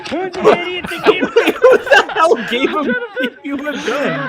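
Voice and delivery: high, loud, fast and laughing. Real, not acted. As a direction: blurt it out while cracking up. Reacting to the clip, laughing.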